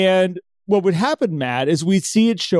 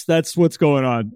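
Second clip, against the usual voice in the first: ordinary talk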